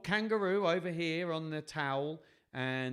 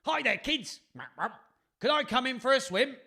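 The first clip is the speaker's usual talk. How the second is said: high, loud, quick doggy voice; eager dog character; 11 semitones higher, 9 dB louder than usual. Acted. Dog asking children for a swim, high eager voice. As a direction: pipe up like an excited dog.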